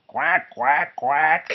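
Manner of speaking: mock duck quack. Duck noise in Duck's boast.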